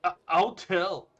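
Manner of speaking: high, loud, slow threat; loud, high, drawn-out warning; stammered threat. Acted. Student threatens to tell.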